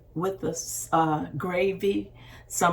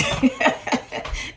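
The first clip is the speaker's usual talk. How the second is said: short laugh; 5 semitones higher than usual. Real, not acted. Laughs at paprika joke.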